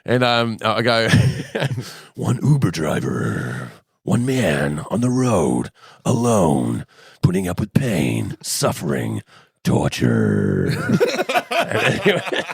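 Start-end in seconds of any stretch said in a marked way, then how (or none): 2.1-10.7 very low, slow, dramatic trailer narrator; movie trailer voice. Pretend. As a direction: intone it like an epic trailer. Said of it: "the American Movie Guy thing".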